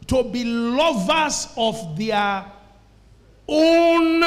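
slow build to a loud, high, long "own"; stress on OWN. Shown as a demonstration. Preacher explains the aim of perilous times, emphasis on "own". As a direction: hold OWN out at full force. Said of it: "making the emphasis key".

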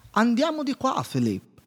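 lifted, cheery voice that drops on the name; coaxing his horse along, light and high; inventor talking to horse. A narrator's reading. Maurice urging his horse Philippe down the path, lightly voiced. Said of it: "disse l'inventore rivolto al cavallo".

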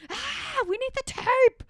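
high, loud panicky squeal; panicked me. Pretend. Imagining herself in the control room, panicking loudly. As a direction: play yourself frantically failing at the job. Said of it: "Could you imagine me in there?".

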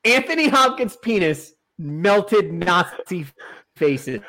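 slow, with a pause before "faces"; dramatically drawn-out declaration, dropping on "faces"; slow, deliberate repeat. Acted. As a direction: spell out "melted Nazi faces" slowly for effect. Repeats it slowly for the internet.

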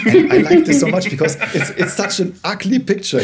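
high, loud laughter through the words; laughing. Real, not acted. Host laughing as he relishes his friend's quote. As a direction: laugh it out, delighted by the image.

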